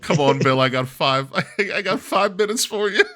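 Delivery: loud, high-pitched taunt through a laugh; mock call-out. Co-host re-enacting the Goldberg call-out, loud mocking taunt.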